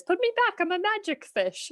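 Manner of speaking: squeaky high fish pleading to be freed; a retold fairy-tale fish, voiced high and pleading; high magic fish voice. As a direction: pitch way up for the little magic fish.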